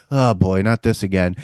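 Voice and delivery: low, soft, weary deadpan; nonplussed resignation. Retold or imitated. Friend watching him stab a guy, nonplussed resignation. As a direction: drop it down, mock-resigned and unimpressed.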